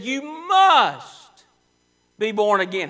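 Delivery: loud, ringing command on must; preacher booms Jesus' command as forcefully as he could. Retold or imitated. Loud forceful command. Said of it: "He said it as forcefully as he could".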